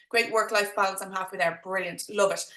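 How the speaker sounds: ordinary talk, nothing marked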